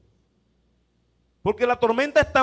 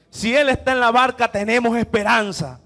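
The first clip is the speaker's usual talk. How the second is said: louder, ringing proclamation; proclaimed hope; 7 dB louder than usual. Acted. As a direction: declare it to the whole church. Repite la frase con fuerza. (proclamación fuerte de esperanza)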